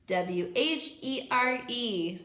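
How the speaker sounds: slow, letter by letter; spelling out. Shown as a demonstration. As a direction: spell each letter clearly for kids. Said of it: "Say it with me".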